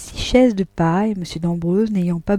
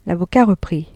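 ordinary delivery, nothing marked

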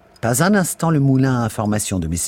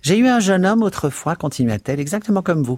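gossiping Mrs Biggs; 8 semitones higher than usual. A narrator's reading. Charwoman telling the lodger about her old beau, high and chatty. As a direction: reminisce up high, relishing it.

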